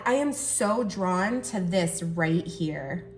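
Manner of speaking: ordinary delivery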